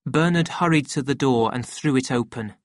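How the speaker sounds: ordinary delivery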